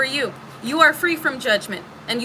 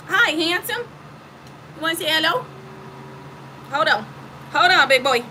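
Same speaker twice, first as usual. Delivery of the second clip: higher, sweet voice for the cat; pet talk; 3 semitones higher than usual. Real, not acted. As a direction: coo at the kitty. Talking to her cat.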